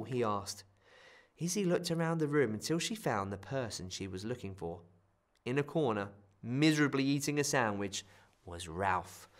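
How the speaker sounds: usual speaking voice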